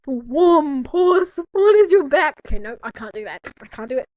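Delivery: ordinary delivery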